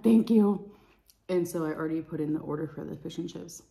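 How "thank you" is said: eager, louder thank you. Retold or imitated. Thanking the server, eager and loud. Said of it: "I'm like".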